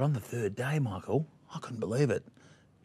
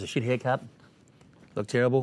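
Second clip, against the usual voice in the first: ordinary talk, nothing marked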